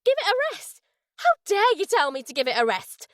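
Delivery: high, furious shout at Dale; shrill soprano fury at her lover; angry outburst. A narrator's reading. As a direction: hurl the words back at him in anger. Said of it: "this time in anger".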